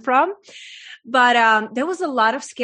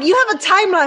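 higher and louder, a playful jab; teasing mock quote; 8 semitones higher, 7 dB louder, faster than usual. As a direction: toss it back high and loud like a friendly tease. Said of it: "You're like".

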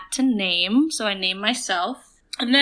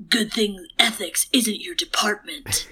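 Batman voice. Acted. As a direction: whisper it like a caped vigilante. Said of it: "can you do it in your Batman voice".

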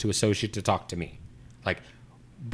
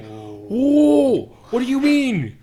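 much higher and louder, a furious "Whoa"; outraged yell; 10 dB louder, much slower than usual. Retold or imitated. Retelling the fight with the restaurant worker, furious yell. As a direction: blow up at the employee in disbelief. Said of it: "I was so heated".

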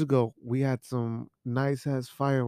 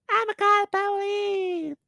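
mock neighbor lady. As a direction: squeak it like a whiny neighbor. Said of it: "neighbor threat quoted".

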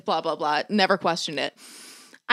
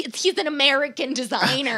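peeved mom; 4 semitones higher than usual. Retold or imitated. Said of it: "it's her biggest pet peeve".

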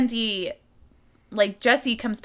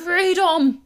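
put-on Scottish accent; 9 semitones higher, 7 dB louder than usual. Braveheart-style Scottish cry.

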